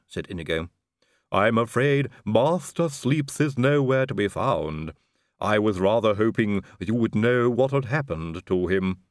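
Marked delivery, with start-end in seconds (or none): 1.3-9.0 slow, lisping servant drawl; a creepy, lisping manservant; Igor's voice. A narrator's reading. Igor explains absent master.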